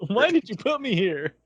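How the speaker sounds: character complaining to the writers; mock complaint. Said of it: "Like she's complaining to the writers".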